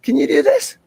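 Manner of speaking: little daughter. Retold or imitated. Daughter upside down challenging her dad, playful child voice. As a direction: mimic a small girl showing off a trick. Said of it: "and she says to me".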